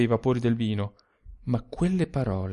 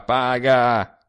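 louder insistent second paga; urging; 2 semitones lower, 4 dB louder than usual. A narrator's reading. Sturzi urges the narrator to pay, louder. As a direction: press him to pay now. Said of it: "urging him to pay".

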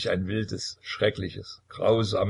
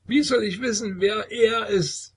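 sehr hoch, langsam, unheimlich; seltsam lächelnd; 13 semitones higher, 3 dB louder than usual. A narrator's reading. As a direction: mit schiefem Lächeln sagen. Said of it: "mit einem so seltsamen Lächeln". (sehr hohe, unheimliche Trinkerstimme)

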